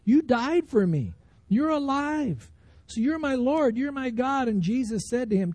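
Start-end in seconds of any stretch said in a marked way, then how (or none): 0.0-4.5 raised, insistent declaration of faith; emphatic retold confession, building higher. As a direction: proclaim it as Thomas, pitch climbing high. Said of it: "He emphatically says".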